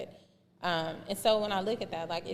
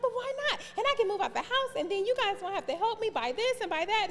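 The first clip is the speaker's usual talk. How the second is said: put-on pleading teen self; faster than usual. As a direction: whine it like a teen arguing with dad. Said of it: "I'm like".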